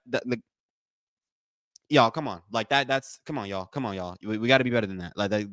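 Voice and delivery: ordinary talk, nothing marked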